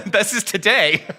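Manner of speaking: chuckling through the words; amused at reading the wrong date; laughing at own mix-up. Real, not acted. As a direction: laugh off the slip. Notes said next Sunday.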